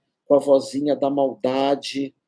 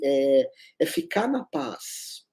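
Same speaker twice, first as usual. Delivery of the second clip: ordinary talk, nothing marked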